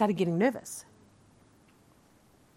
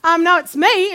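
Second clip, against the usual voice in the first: laughing correction; 11 semitones higher, 15 dB louder than usual. Retold or imitated. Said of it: "I'm like".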